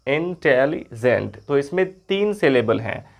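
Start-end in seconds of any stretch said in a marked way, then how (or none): 0.1-1.4 इंटेलिजेंट said slowly in three parts, in-tel-li-gent; split into syllables. Shown as a demonstration. Teacher showing students the three syllables of इंटेलिजेंट, slowly. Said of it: "तीन part में हम इसको बोल रहे हैं".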